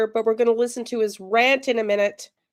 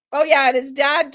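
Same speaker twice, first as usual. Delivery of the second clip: ordinary talk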